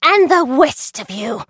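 high, weedy teacher trying to sound stern; squeaky schoolmistress scolding, slowly; thin reedy voice. Acted. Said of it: "shouted Miss Dingle".

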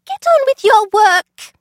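high, weedy teacher trying to sound stern; thin reedy voice. Acted. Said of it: "snapped Miss Dingle".

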